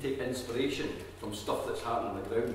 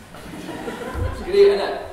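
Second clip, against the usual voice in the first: laughing out loud through the words; a real burst of laughter while praising; 10 semitones higher, 14 dB louder, much slower than usual. Real, not acted. Praising a colleague's accent.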